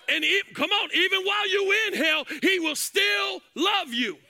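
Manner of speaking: rising, shouted preaching over music; full-force preaching. Acted. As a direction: preach it hard and loud, building.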